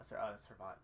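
ordinary talk, nothing marked

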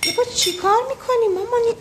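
high, cooing voice to her mother; sweet baby talk. Acted. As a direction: coo it like talking to a small child. Daughter greets her mother sweetly. (با صدای بچگانه و شیرین برای مادر)

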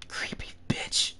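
soft scared whisper. Real, not acted. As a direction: mutter it under your breath. Whispered at the creepy ghost.

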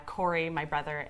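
usual speaking voice

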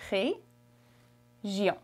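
région with a throaty French R, rayzhion; French pronunciation. Shown as a demonstration. Teacher showing the tricky French R to learners. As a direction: roll the back of the throat on région. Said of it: "those tricky R's".

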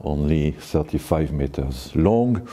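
ordinary talk, nothing marked

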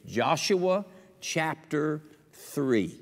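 drawn-out chapter announcement; slow drawn-out reference. Shown as a demonstration. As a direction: stretch "Joshua chapter three" slowly. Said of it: "I want you to remember this".

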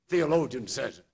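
usual speaking voice

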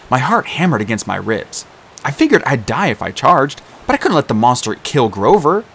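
plain reading voice

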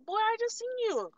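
can't believe his denial; incredulous retort. Retold or imitated. Answering the worker's lie, high and incredulous.

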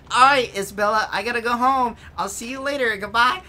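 lightly voiced cartoon boy; boyish voice. Boy brushing off his friend, lightly voiced. Phineas voice, raised.

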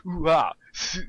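loud, hammed-up fake Japanese accent; overdone Japanese-accent gushing; mock Japanese accent. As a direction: imitate an anime fan's exaggerated Japanese. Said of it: "in this pseudo-racist accent".

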